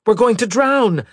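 high, loud cry of alarm; panicked shout. A narrator's reading. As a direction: shout it out as the cabin floods. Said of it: "Meta shouted".

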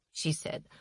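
ordinary delivery

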